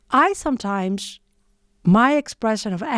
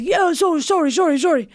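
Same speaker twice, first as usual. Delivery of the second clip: higher, hurried mock apology; surprised apologetic man; 7 semitones higher, faster than usual. Startled cyclist apologising to angry driver, lightly mocked higher voice. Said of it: "he got a little bit surprised".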